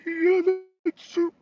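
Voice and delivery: high, soft, meek customer voice; mock frightened diner; timid customer. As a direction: squeak the answer up high, cowed. Cowed customer answers.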